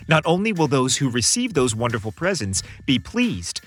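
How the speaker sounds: ordinary delivery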